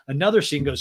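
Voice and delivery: normal talking voice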